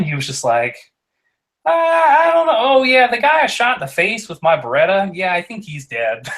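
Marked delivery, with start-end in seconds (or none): 1.6-6.3 high, breezy offhand tone; casual high voice. Retold or imitated. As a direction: toss it off lightly, way up high. Pete's casual killing answer.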